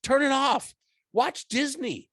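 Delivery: squeaky mock advice; high mocking voice.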